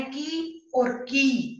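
slow, loud "की और की", first vowel short, second long; short versus long. Shown as a demonstration. Teacher contrasts two words for pupils, short then long "की". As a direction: clip the first "की", stretch the second. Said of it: "जिसका आपको ध्यान देना है".

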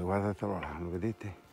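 ordinary talk

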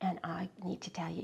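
quick confiding whisper. Real, not acted. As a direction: lean in and whisper it fast. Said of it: "whispered aside to listeners".

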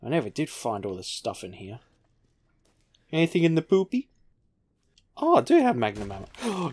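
ordinary delivery

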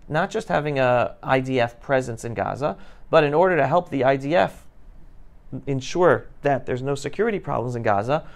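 ordinary talk, nothing marked

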